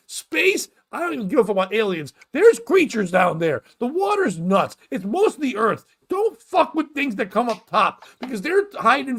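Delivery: high-pitched comic outrage; exasperated, hammed-up tirade; ranting. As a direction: rant in mock fury, voice pushed up high. Comic rant about staying out of water.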